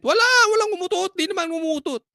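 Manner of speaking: high-pitched, hurried mock outrage; indignant denial. Angry ones insisting nobody farted, indignant. Acts out angry people denying it.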